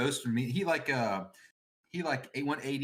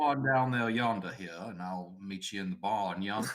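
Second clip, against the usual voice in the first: hammed-up backwoods Southern drawl; stretched Southern country twang; 4 semitones lower than usual. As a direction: call the boys in a thick mock Southern drawl. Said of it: "like, a Southern drawl".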